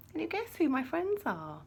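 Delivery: ordinary delivery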